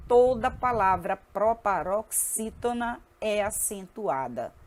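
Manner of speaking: measured, spaced-out words; deliberate teacher dictation; slow rule recitation. Shown as a demonstration. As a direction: state the rule slowly, syllable by syllable.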